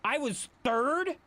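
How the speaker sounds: high, slow, disbelieving question; can't believe it, drag it out high; surprised. Real, not acted. Surprised at own ranking.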